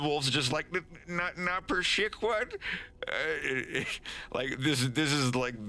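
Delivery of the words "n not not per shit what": higher, stammering falter; flustered high stammer; despairing. Acted. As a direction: stumble on helplessly while being laughed at. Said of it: "the peak of Wolf's despair".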